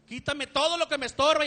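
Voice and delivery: loud, high mock prayer; a loud pleading quote; imitated prayer cry. Quoting the prayer loudly.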